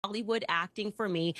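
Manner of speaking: normal talking voice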